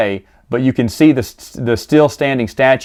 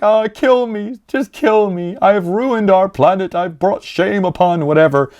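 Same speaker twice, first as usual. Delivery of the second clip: higher, hammed-up despair; mock despairing warrior; 6 semitones higher than usual. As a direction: overplay the shame. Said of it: "The warrior's like".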